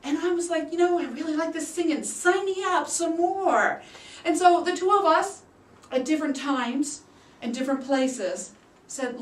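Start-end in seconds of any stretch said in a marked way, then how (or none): 0.7-3.8 high eager gush, slowing at the end; excited quote. Retold or imitated. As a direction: sell it like a thrilled beginner. His own excited retold quote.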